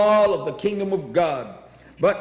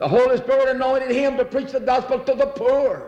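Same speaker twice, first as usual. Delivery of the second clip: preaching climax; 6 semitones higher than usual. Acted. Preacher rising to a peak.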